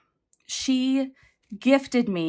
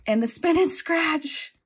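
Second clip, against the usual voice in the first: excited; 8 semitones higher, slower than usual. Real, not acted. Excited unboxing.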